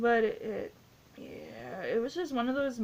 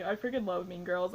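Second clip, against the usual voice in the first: ordinary talk, nothing marked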